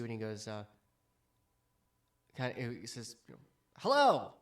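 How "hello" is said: high, booming hello in character; hearty loud dwarf greeting; loud innkeeper greeting. Acted. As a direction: call out a big hello from the bar.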